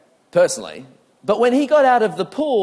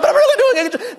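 much higher, louder, laughing false cheer; forced cheer; 5 dB louder, much faster than usual. Retold or imitated. Person insisting they are fine with laughing false cheer. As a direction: strain to sound convinced. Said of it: "Even though they go".

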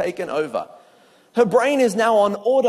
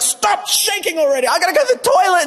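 high, laughing mock panic; a girl losing her nerve, played for laughs; panicked girl; 9 semitones higher, 4 dB louder, faster than usual. Nervous girl at assembly in high laughing mock panic. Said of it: "She's like".

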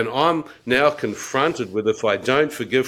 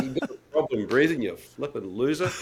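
mocking scorn; 5 dB quieter than usual. His own retold scornful reply.

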